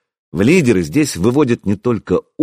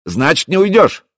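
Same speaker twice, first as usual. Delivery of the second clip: higher, raised call; shouting; 7 semitones higher than usual. A narrator's reading. Prince challenging the stubborn hero, shouting. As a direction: call it out to the defender. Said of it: "крикнул он".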